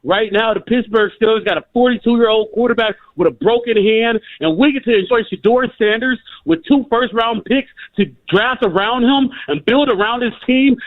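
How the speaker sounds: a caller too hyped to contain himself, rising to a yelled slogan; emotional hype. Real, not acted. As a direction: lose it with excitement about the team, cheering. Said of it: "I'm sorry, I'm emotional".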